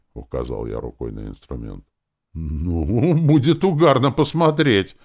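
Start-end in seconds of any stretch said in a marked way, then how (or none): 2.3-5.0 drawn-in start, then high and loud amusement; smiling amusement. A narrator's reading. Druid amused by the harp, smiling. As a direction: say it with a smile, lifting the voice. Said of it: "улыбнулся Таварин".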